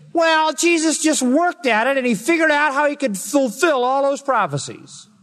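high, sneering put-on voice of a skeptic; mocking liberal skeptic. Preacher mocking liberal critics to his congregation, in a high sneering voice. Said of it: "pea-brained liberals come along and they say".

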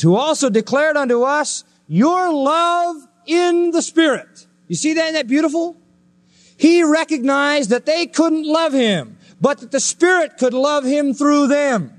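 high, slow, marveling tone; awed preaching. Real, not acted. Preacher delighting in a little verse, high and slow with wonder. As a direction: savor the verse like a treasure.

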